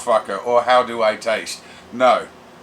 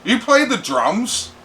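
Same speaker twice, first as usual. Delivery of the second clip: imitating Tyler; 9 semitones higher than usual. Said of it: "and Tyler goes".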